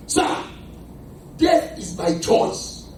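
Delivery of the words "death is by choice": ordinary talk, nothing marked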